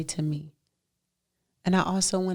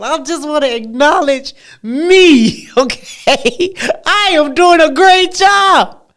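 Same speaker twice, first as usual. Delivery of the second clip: excited loud boast; 7 semitones higher, 12 dB louder than usual. Real, not acted. Preacher loudly boasting she is doing a great job. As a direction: shout your pride to the crowd. Bragging about herself, shouting.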